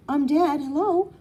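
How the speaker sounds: put-on high, loud child's greeting; little girl voice. A narrator's reading. Small daughter greeting her dad, high and loud. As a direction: pipe it up like an eager small girl. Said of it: "she says".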